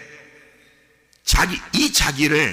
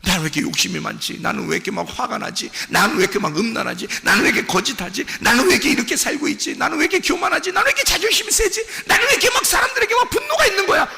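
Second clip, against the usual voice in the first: escalating self-questioning; 10 semitones higher, faster than usual. Acted. Sinner asking why they are this way, escalating exasperation. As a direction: pile up the questions with mounting exasperation.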